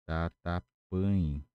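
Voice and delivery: tatapan spoken very slowly, syllable by syllable; very slow pronunciation. Shown as a demonstration. Teacher introducing the Guarani word for embers, very slow. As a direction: stretch out tatapan so students catch it. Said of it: "brasa em Guarani seria".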